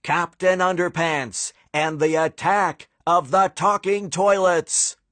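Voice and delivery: upbeat coming-attractions read; trailer announcer. Acted. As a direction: sell the next adventure like a movie trailer. Trailer voiceover.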